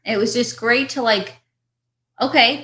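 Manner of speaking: ordinary delivery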